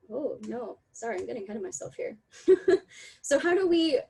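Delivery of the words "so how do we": laughing. Real, not acted. Apologises for getting ahead, laughs.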